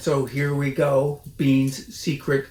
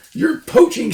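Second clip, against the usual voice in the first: high, loud squeaky shriek; shrieking Rat; 11 dB louder than usual. A narrator's reading. Rat shrieks at Mister Fox to leave. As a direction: screech like an outraged rat. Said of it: "shrieked the Rat".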